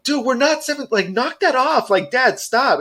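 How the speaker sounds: put-on high-pitched exasperated teen girls; protesting daughters. Girls telling their dad to knock it off, protesting. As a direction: imitate girls telling a parent off, voice way up.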